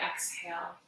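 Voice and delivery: ordinary delivery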